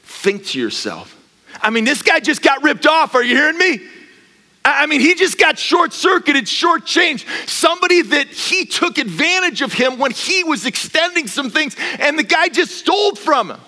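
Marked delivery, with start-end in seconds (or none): 1.6-13.6 loud, high indignant preaching; full-force preaching. Acted. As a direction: drive it home with loud outrage.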